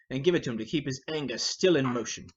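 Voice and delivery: ordinary delivery, nothing marked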